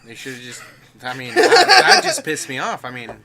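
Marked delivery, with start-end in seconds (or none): 1.3-2.8 loud, high laughing protest; laughing outburst. Real, not acted. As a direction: blurt it out through a laugh. Laughing outrage at the story.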